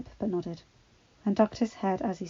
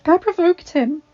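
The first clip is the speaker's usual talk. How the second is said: tearful admission; 11 semitones higher, 12 dB louder than usual. A narrator's reading. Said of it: "she admitted".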